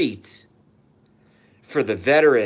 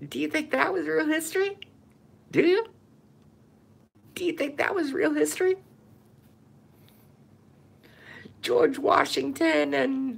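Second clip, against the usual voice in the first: much higher, sing-song mocking voice; mocking taunt; 14 semitones higher than usual. Ranter mocks viewers who trust school history, high taunting voice. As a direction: taunt them like silly children.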